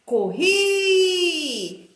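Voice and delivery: "Corri" with a long, sustained last syllable; calling out. Shown as a demonstration. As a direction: call it like summoning a little friend. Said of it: "como se eu tivesse chamando".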